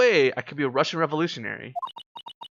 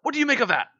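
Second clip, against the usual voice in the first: much higher, triumphant "See? See?"; gloating; 11 semitones higher than usual. Acted. Detective gloating at the accused, excited and high. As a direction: crow over the win.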